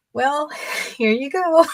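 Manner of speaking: high, giggly hand-over; laughing sheepishly. Retold or imitated. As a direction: laugh through it while handing it over. Handing over a huge cone.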